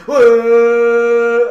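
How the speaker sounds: loud, high cheering whoa; crowd cheer. Retold or imitated. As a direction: roar like a hyped stadium crowd. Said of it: "and everyone's like".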